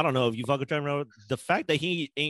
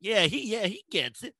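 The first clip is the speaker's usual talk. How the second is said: mock fan; 6 semitones higher than usual. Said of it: "American people be eating that shit up like".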